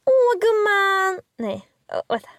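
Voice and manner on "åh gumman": put-on high, drawn-out Stockholm accent, slow. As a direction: overdo the Stockholm gush. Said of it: "jag kan göra det bättre". (överdriven stockholmsdialekt)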